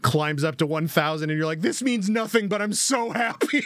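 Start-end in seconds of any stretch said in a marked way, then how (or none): 1.6-3.6 higher, gushing mock delight; mock-giddy, pitched-up gush; giddy fan voice. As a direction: squeal it like an overjoyed fan.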